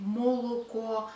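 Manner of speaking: молоко as "MO-lo-ko" with every o sounded; over-pronounce each o in молоко; wrong full o. Shown as a demonstration. Teacher showing what isn't said, молоко with full o's. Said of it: "we don't say".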